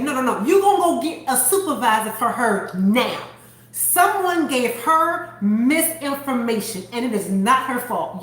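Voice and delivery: emphatic retold scolding; firm, indignant mock demand; going off. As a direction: imitate a customer standing up for a stranger. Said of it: "she was going off on my behalf".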